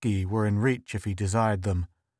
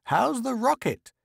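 put-on very high, piping old woman; piping mother-in-law; 12 semitones higher than usual. A narrator's reading. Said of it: "piped mother-in-law".

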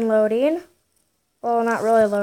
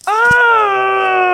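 long high shouted "oh"; loud cry; 10 semitones higher, 7 dB louder than usual. Real, not acted. Player reacting to the game, long high cry. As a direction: let out a drawn-out high yell.

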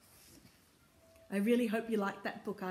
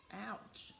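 usual speaking voice

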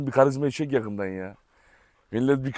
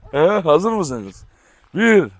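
ordinary delivery

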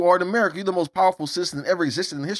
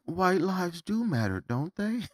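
softer and slower, drawn-out teasing question; mock hushed question; 9 dB quieter, slower than usual. Needling his viewers with a softer, slow question.

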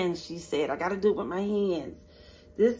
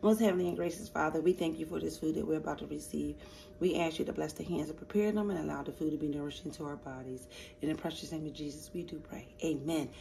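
soft, quick prayer under her breath; grace before meal; 4 semitones lower, 10 dB quieter than usual. Acted. Vlogger blesses her chitlin plate, soft murmured prayer. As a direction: murmur grace quietly to the Father.

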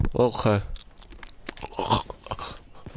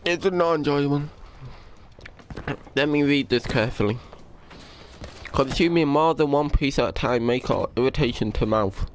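usual speaking voice

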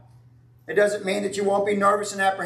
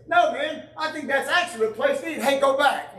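imitating Brian; 5 semitones higher than usual. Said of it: "he looks back and says".

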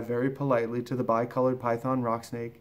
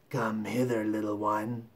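low, softer crocodile voice; coaxing crocodile; 3 semitones lower, 4 dB quieter than usual. Acted. Crocodile coaxing the elephant child closer, low and soft. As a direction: lure the little one in quietly.

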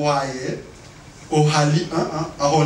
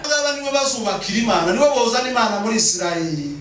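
high sermon proclamation; rousing preaching; 9 semitones higher than usual. Real, not acted. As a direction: rouse the congregation. Preaches at full force.

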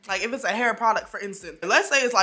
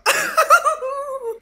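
loud high laugh; 8 dB louder than usual. Real, not acted. Laughs at found topic.

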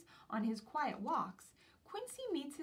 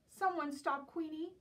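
high, loud call for help; 6 semitones higher, 5 dB louder than usual. Acted. Quincy calling for help as Queenie runs, high loud call. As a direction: call out to anyone nearby.

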